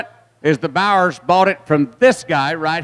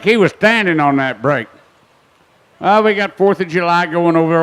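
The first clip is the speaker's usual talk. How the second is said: ordinary talk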